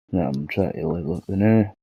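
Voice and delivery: ordinary delivery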